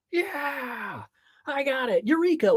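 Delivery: high, rising triumphant yell; excited shout. Shown as a demonstration. Coach demonstrating an outdoor scream, excited shout. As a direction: shout it out like a eureka moment. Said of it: "you scream something".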